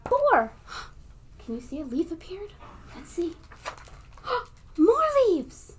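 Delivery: gasping, high and amazed. Acted. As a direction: act wide-eyed wonder for kids. Counting to magic reveal.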